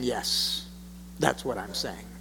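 low, hushed quoted reply; understated, soft and low yes; quiet confirmation. Acted. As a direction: drop right down and confirm it quietly. Voices Jesus's calm confirming reply.

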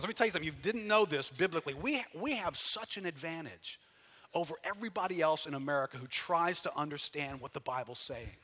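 ordinary talk, nothing marked

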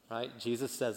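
ordinary talk, nothing marked

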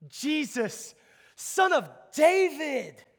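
crying out high and loud, words drawn out; long, high, loud call; fierce yell. Retold or imitated. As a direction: yell it like the blind man calling for the king. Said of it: "he's yelling something fierce".

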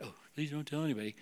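hushed, hurried plea; mock anxious. Nervous giver pleading for secrecy, mock anxious and hushed. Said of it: "So you get all weird about".

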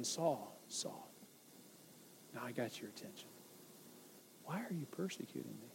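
whispered Saul Saul, barely there; soft whisper. Shown as a demonstration. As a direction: breathe it gently like a quiet inner voice. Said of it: "Or it could have been".

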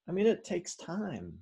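high, easy-paced assertion; lilting emphasis. Real, not acted. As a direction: lift "takes time" up high at normal pace. First take, before drawn-out repeat.

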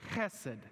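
"chesed" with a throaty guttural ch; Hebrew chesed. Shown as a demonstration. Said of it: "we learned the Hebrew word".

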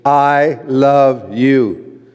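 drawn-out "I love you" leaning on "love"; sincere, stretched-out declaration; slow, tender I love you. Shown as a demonstration. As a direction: say it slowly, like you mean it. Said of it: "Say that with me".

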